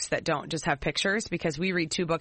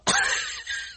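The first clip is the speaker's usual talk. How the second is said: shrieking laugh. Real, not acted. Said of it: "That's brilliant".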